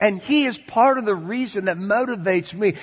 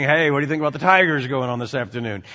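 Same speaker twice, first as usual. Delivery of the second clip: quick casual chatter; 6 semitones lower, much faster than usual. Retold or imitated. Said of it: "instead of saying".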